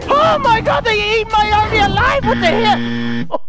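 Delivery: shocked shout. Real, not acted. Gamer watching his army eaten, shocked shout. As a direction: cry out at the loss.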